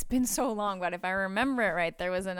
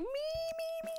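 much higher, squealing "me, me, me"; eager volunteers; 5 dB quieter, slower than usual. Retold or imitated. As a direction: beg to be picked like a thrilled fan. Everybody volunteers for the concert.

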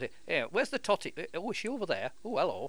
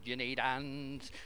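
put-on slow crooning, lightly sung like an old entertainer; slower than usual. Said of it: "my [name] impression".

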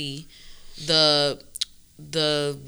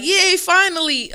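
excited cheer; 13 semitones higher, 5 dB louder than usual. Retold or imitated. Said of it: "people were like".

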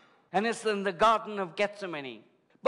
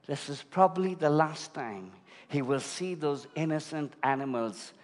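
low, softened, solemn sermon storytelling; hushed preaching; 8 semitones lower, 5 dB quieter than usual. Real, not acted. Preacher describes the Passover lambs to congregation, low and solemn. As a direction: lower and soften it to paint a grave picture.

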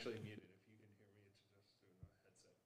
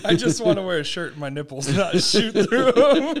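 laughing; 6 semitones higher, 7 dB louder than usual. Retold or imitated. Retold comedian quote, laughing.

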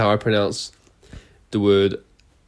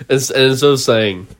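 normal talking voice

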